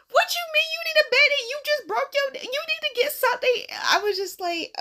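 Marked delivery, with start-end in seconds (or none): 0.1-3.6 high-pitched, hurried disbelief; mock-outraged, high voice; incredulous retort. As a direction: retell a baffled comeback way up high. Acted disbelieving retort to friend.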